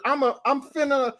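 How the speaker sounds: Southern yokel. Acted. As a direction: play the yokel scolding a stranger. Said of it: "A Southern Yokel in the Court".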